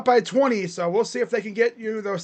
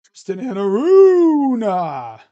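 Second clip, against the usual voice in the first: drawn-out hype call; 3 semitones higher, 11 dB louder, slower than usual. Real, not acted. Hyped name call after three.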